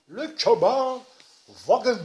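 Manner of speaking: loud, slow, drawn-out botched English accent; put-on English accent. Shown as a demonstration. Vlogger unveiling the Cubenwagen to viewers, mock English accent. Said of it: "j'ai mis l'accent anglais".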